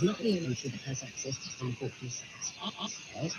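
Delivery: ordinary delivery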